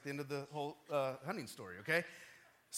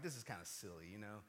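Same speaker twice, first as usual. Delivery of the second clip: soft, low aside to oneself; inner voice; 10 semitones lower, 12 dB quieter than usual. Retold or imitated. Man's conscience questioning his own prayer, hushed and low. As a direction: drop to a quiet, doubting murmur. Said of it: "my conscience, I'm like".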